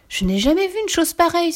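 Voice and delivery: high-pitched astonished exclamation; amazed cry. A narrator's reading. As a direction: cry out at a discovery. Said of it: "s'écria-t-elle". (cri d'étonnement)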